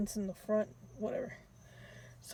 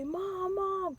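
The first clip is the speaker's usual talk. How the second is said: high urgent call; 9 semitones higher than usual. Retold or imitated. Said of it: "We say".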